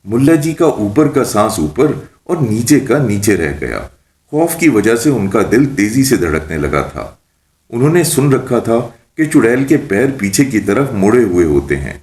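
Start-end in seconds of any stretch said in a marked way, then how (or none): none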